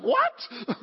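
high-pitched mock-startled yelp; shocked. Preacher imitates wife hearing of the crash, shocked. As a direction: react as the alarmed wife, hammed-up.

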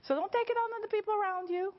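high, laughing through the warning. Real, not acted. Preacher laughing while warning the congregation. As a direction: chuckle the words out, half amused.

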